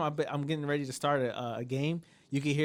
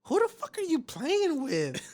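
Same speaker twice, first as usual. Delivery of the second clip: high, slow mock wife voice; wife shouting at him over the game, very high; imitating wife; slower than usual. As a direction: play the outraged wife. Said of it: "my wife's like".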